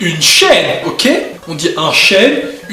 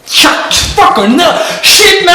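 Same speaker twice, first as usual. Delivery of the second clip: juron crié; 10 semitones higher, 3 dB louder, much slower than usual. Pretend. Juron en anglais soudain.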